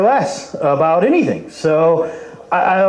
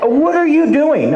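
incredulous; 9 semitones higher, slower than usual. Retold or imitated. Retold disbelief at mother in van.

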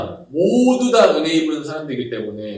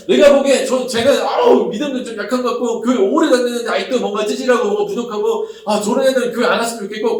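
higher, louder sneering complaint; mock contempt; 6 semitones higher, 5 dB louder than usual. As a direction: look down on the weaker ones. 남을 깔보는 교인 흉내. (높고 크게 깔보는 투덜거림)

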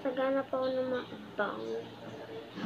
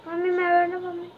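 calling out to mom; 5 semitones higher, 4 dB louder, faster than usual. Real, not acted. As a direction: call across the house. Calling out to mother.

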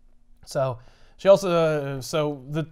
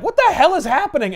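high, louder alarmed cry; alarmed outburst; 10 semitones higher, 5 dB louder than usual. Retold or imitated. Spider-Man seeing two people collapse, alarmed. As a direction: demand it in shock. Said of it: "Spider-Man's like".

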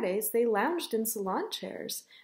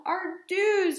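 high-pitched mock boo-hoo; 8 semitones higher, 3 dB louder than usual. Ava's mother mourns her hairdo, mock boo-hooing. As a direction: wail it in play-acted tears. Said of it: "boo-hooed Ava's mother".

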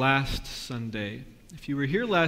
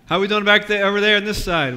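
loud and higher, calling to the back rows; 5 semitones higher, 10 dB louder, faster than usual. Real, not acted. Pastor calling out to the congregation at the back, loud. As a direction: project up to the far pews.

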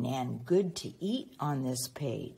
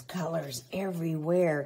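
ordinary delivery

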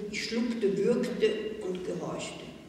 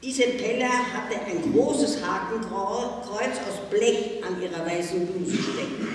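ordinary delivery

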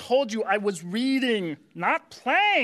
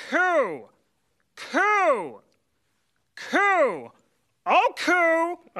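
pigeon cooing; 5 semitones higher, much slower than usual. Shown as a demonstration. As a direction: coo like a proper pigeon. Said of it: "This is a pigeon".

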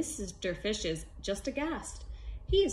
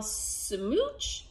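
very slow, stretched smooch; drawn-out smooch; 3 semitones higher than usual. Acted. The happy fish ending on a slow smooch. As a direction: draw the smooch out long and slow.

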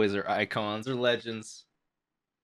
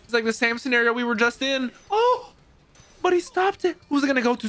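high, fast-building hype over the final play; excited play-by-play; 12 semitones higher than usual. Real, not acted. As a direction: call it like the last seconds of a game. Hyped watching the game climax.

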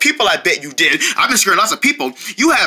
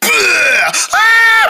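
startling boo; 10 semitones higher, 4 dB louder than usual. Acted. Jump scare scream.

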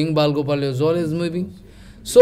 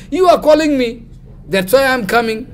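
raised, high-pitched self-defence; insistent protest; 6 semitones higher, 4 dB louder than usual. Retold or imitated. Gopal answers the sannyasi who called him, protesting. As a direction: protest in a higher voice, putting it back on the listener.

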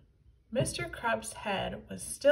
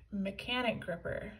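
ordinary delivery

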